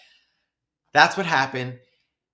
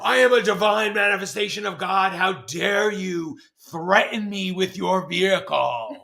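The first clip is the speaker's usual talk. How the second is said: high, loud, theatrical indignation; grandiose rage; 6 semitones higher, 4 dB louder than usual. Retold or imitated. Pedestrian declaring divinity to a threatening driver, grandiose rage. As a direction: proclaim it like an outraged deity. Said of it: "that was literally the energy of it".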